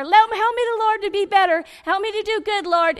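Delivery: loud, high mock plea; hammed-up begging to the Lord; mock urgent prayer. Woman who won't let God talk, mock urgent prayer. Mimics one-sided frantic prayer.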